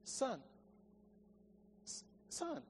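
very soft, halting appeal; a father's hushed plea to his son; pleading father. Acted. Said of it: "hear it in the father's voice".

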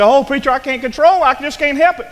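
high, loud whining mock protest; whiny complainer. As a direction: whine it like an excuse-maker. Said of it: "You say".

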